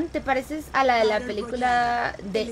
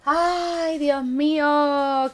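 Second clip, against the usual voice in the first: dismayed sigh; 3 semitones higher, 6 dB louder, much slower than usual. Real, not acted. Streamer reacts dismayed in game.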